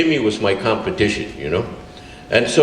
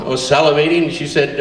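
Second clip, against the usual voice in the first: normal talking voice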